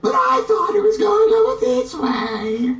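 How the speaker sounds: high falsetto lady voice; Monty Python lady falsetto; bad woman's voice. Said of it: "a bad woman's voice".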